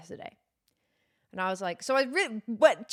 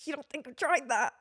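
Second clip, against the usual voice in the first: strained, higher-pitched mock outburst; exasperated retort; 5 semitones higher than usual. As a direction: act out exasperation at useless advice. Acted exasperated retort to doctor.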